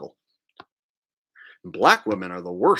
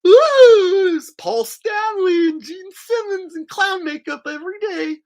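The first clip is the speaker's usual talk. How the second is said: high, loud, squealing mock woman; mocking high-pitched woman's shout; 10 dB louder than usual. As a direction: shriek it like a made-up girl. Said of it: "painting 'em on".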